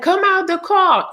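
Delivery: high-pitched mock police megaphone order; imitated megaphone command. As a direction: call it out high like an officer on a megaphone. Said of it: "the megaphone".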